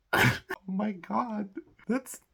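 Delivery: high, slow, hammed-up dismay; high slow mock horror; mock sympathy. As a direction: fake shocked sympathy, drawn out. Mocking fake sympathy.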